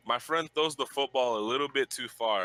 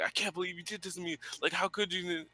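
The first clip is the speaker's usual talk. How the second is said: mimicking angry girl; 7 semitones higher, 3 dB quieter than usual.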